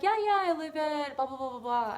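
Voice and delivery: imitating the girl. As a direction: toss it off like the caller. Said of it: "So she's like".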